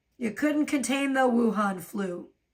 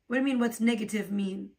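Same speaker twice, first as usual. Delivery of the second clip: normal talking voice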